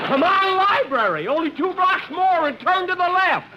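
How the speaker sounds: high, loud urging yell; root for your horse down the stretch; cheering a horse. Acted. Jack yells at his horse during the race, loud high cheering.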